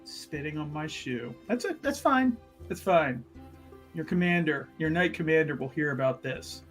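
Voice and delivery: low, hushed threat of a complaint; low muttered grievance. Acted. Offended citizen to the man who spat, quiet grumbling.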